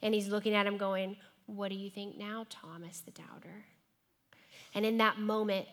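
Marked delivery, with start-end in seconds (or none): 1.5-3.7 softer, ending in a whisper; a snide, hushed dig at the doubter; quiet passive-aggressive taunt, trailing to a whisper. Retold or imitated. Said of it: "passive-aggressive Jesus".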